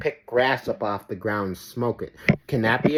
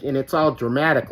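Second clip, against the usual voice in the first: usual speaking voice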